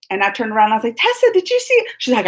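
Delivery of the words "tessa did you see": much higher, thrilled; excited squeal. Retold or imitated. Telling her friend Tessa about the encounter, excited squeal.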